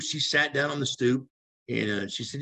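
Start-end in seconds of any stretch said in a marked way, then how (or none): none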